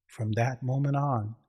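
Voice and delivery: plain reading voice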